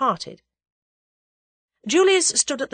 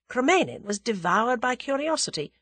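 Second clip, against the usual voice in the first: usual speaking voice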